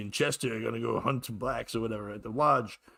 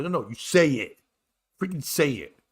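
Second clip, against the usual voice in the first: raised, forceful order; insistent angry demand; 4 semitones higher, 5 dB louder than usual. Retold or imitated. Passenger ordering driver to admit it, angry and insistent. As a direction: demand it with exasperation.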